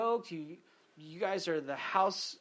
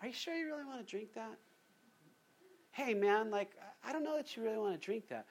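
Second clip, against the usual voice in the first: higher, softer, fast and overly polite; timid nice-guy quote; 4 semitones higher, 3 dB quieter, faster than usual. Retold or imitated. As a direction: tiptoe around it like Mister Nice Guy. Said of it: "would you expect me to nicely say".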